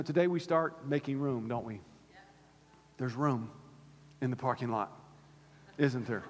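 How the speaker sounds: ordinary talk, nothing marked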